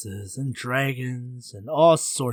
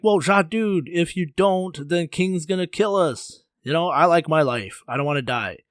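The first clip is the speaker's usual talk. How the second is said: higher, louder plea from frightened villagers; urgent pleading; 5 semitones higher, 7 dB louder than usual. Acted. Villagers beg Zha to save them from King, urgent pleading. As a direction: beg your friend with raised voice.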